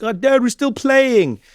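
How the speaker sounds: put-on high, slow whining boy; a boy refusing to come to dinner, high whine; whiny kid protest. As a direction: drag it out like a kid begging to keep playing. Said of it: "then he was like".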